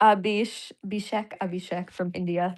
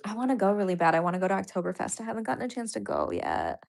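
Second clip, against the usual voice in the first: ordinary talk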